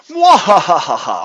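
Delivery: evil villain laugh. Retold or imitated. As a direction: cackle like a scheming villain. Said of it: "I have this vision of these bears going".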